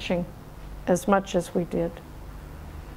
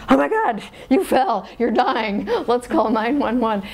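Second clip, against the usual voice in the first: overreact like a panicking parent, lightly hammed-up; mock parental panic; 3 semitones higher than usual. American parents to a fallen toddler, mock panic. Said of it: "it's the opposite effect".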